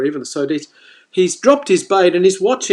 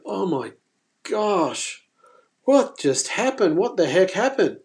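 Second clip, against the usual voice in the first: retold shock; 3 semitones higher, 4 dB quieter than usual. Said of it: "we're just in shock".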